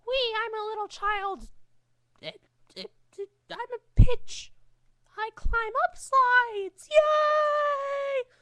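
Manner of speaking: put-on very high squeaky child voice; little child voice. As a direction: squeal it like a giddy little kid.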